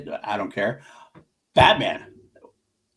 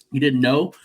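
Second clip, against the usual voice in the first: normal talking voice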